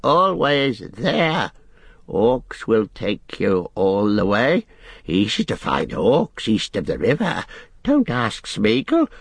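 slow, sly creature voice; Gollum cackling. Acted. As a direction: drawl it slyly as a creature. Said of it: "he cackled".

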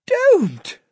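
sharp high plea to stop; pleading. Acted. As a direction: cry out in horror. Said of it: "Lucy cuts him off".

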